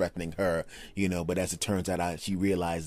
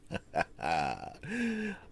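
laugh; 4 semitones higher, 8 dB quieter than usual. Real, not acted. Laughing at his own rant.

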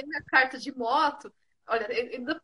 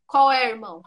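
mock tough challenge; 2 semitones lower, 8 dB louder than usual. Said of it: "Porque eu tô lá assim".

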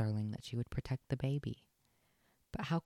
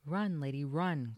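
louder urgent call while fleeing; 4 semitones higher, 6 dB louder, slower than usual. A narrator's reading. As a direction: call her to run, loud and urgent. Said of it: "called Tramp".

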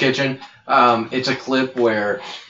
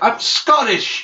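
much higher, slow outraged cry; indignant mock shout; 10 semitones higher, slower than usual. Chef corrects a contestant calling him British, mock outrage. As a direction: protest it like an offended chef. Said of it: "He's like".

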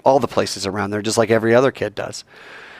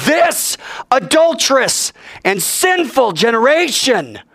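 preached emphasis; 4 dB louder, slower than usual. Acted. Preacher reading text aloud.

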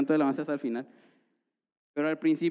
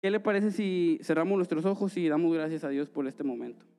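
usual speaking voice

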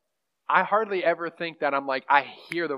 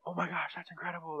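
hushed mock awe; mock amazement; 3 semitones higher, 10 dB quieter than usual. Speaker showing the reaction he didn't have, mock amazement. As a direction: imitate an awestruck reaction under your breath. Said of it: "I wasn't like".

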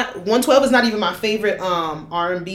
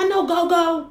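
much higher, put-on voice of a poser; mock know-it-all; 10 semitones higher than usual. Imitating outsiders who claim go-go, mocking high voice. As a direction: send up someone bragging they know go-go.

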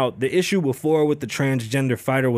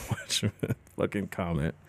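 whispered, laughing warning; hushed warning to a chat commenter; 8 semitones lower, 11 dB quieter than usual. Real, not acted. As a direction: breathe it out as a half-joking threat.

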